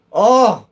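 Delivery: loud, high mock roar for the cat. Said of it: "And he roars".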